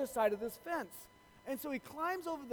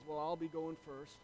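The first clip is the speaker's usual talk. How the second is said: lightly voiced farmer, low and soft; understated, quiet old farmer; 6 semitones lower, 8 dB quieter than usual. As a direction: announce it low, a hint of the farmer. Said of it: "farmer gets off of his tractor".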